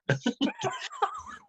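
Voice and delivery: high burst of real laughter. Real, not acted. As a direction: crack up at the punchline. Laughing at his own punchline.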